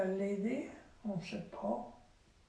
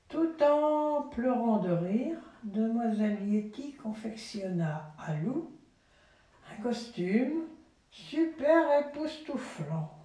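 slowed, lifted read-aloud; storybook reading voice; story narration; 3 semitones higher, slower than usual. A narrator's reading. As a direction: savour the wonder for the child.

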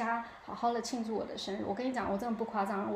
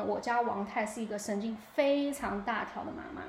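ordinary talk, nothing marked

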